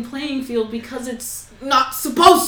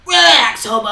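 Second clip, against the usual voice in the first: dismissive; 10 dB louder than usual. Retold or imitated. Mocking someone pushing ships into canon.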